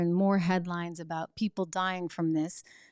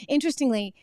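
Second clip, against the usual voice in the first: normal talking voice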